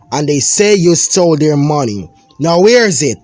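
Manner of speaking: angry dad interrogating his son; accusing father. Acted. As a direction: corner the boy, voice rising.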